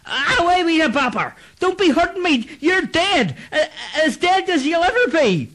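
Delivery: high, frantic voice of a frightened man, near tears; terrified plea. Acted. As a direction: beg the ghost to stay away, voice cracking. Clancy terrified by dead friend.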